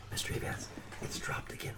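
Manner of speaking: hushed classroom whisper. Retold or imitated. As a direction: whisper so the teacher won't hear. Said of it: "why were they whispering".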